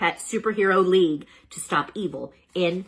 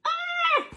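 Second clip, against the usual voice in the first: high cartoon shriek; panicked scream. A narrator's reading. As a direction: shriek in alarm.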